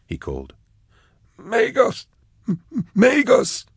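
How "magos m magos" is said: very high, broken gurgling cry; gurgled wretch. A narrator's reading. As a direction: gurgle the name like a crazed wretch. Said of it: "the naked wretch in the cage gurgled back".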